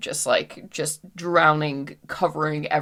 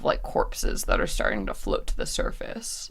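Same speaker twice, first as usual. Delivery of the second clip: ordinary delivery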